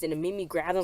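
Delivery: ordinary delivery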